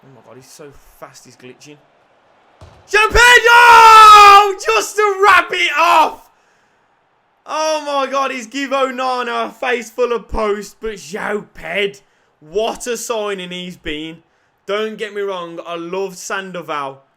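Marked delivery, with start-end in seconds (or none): 2.9-6.3 screamed at the top of the lungs, high and slow; ecstatic goal shout. Real, not acted. Goal celebration commentary shout. 7.4-17.0 raised, buzzing excitement after the goal; excited gush. Real, not acted.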